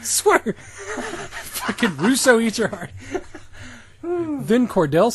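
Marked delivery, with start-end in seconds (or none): none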